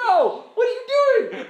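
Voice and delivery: very high, incredulous cry; hammed-up "what on earth" outburst; mock exasperated protest. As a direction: squeal it in comic dismay. Acts offended believer questioning God.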